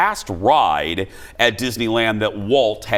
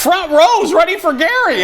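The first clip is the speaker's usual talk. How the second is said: high, loud arena announcer; announcer hype; 5 dB louder than usual. Acted. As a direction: pump up the crowd. Hyping the crowd for Gary.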